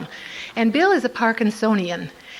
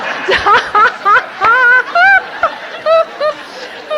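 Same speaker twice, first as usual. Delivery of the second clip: hearty laugh; 7 dB louder than usual. Real, not acted. Big laugh.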